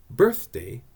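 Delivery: "birthday" with the first syllable stressed; slow "birthday", strong then weak. Shown as a demonstration. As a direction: lean on the start of "birthday". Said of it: "is on the first syllable".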